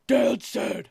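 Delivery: impression of Dean quoting his dad; like Tattoo. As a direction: snap it out in Tattoo's voice. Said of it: "He says it exactly like Tattoo".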